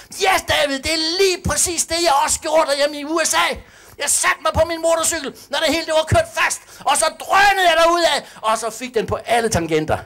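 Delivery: high, loud, hyped retelling of a pastor; excited American pastor. Retold or imitated. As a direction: shout it out like a fired-up preacher. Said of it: "han sagde".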